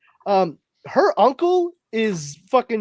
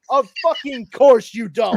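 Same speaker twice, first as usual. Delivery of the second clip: loud, higher mock exasperation; retold exasperation; 5 semitones higher, 6 dB louder than usual. Husband retelling his reply to his wife, mock exasperated. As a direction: throw it out loudly in comic frustration.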